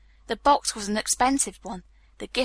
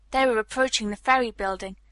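plain reading voice

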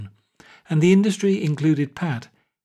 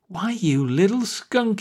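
slow, higher, mock-furious insult; angry cry; 7 semitones higher, slower than usual. Wall realises Pat is Bannerson's spy, slow angry cry. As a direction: spit the insult slowly at him. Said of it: "he cried".

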